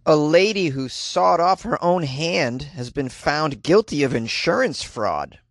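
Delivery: normal talking voice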